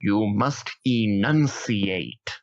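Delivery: slow, stately enunciation; a mock elocution teacher, very slow; pompous elocution. Speaker playing a stuffy elocution teacher, slow and grand. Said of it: "If I may".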